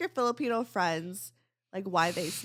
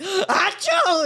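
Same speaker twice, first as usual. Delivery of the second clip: big fake sneeze, loud and high; 10 semitones higher, 9 dB louder than usual. Shown as a demonstration. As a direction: belt out a sneeze. Demo of loud Filipino sneeze.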